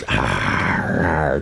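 low, jawless arr growl; a hammed-up pirate arr. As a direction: growl it like a ghost missing his jaw. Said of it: "talks like this, you know, like a pirate".

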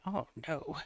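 very soft, low "oh, no"; hushed, low aside of dawning dread; dreading inner thought. Retold or imitated. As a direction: murmur it like a worried thought to yourself. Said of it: "kept looking at him thinking".

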